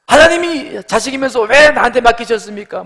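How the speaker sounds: loud, high, accusing cry; angry protest. Acted. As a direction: demand an answer from God in acted outrage. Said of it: "화를 내는 거죠".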